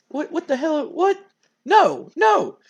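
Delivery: high-pitched mock alarm; acted bewilderment. Wrestler reacting to his partner's come-on, bewildered. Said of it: "he's like".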